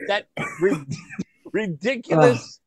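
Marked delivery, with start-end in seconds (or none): none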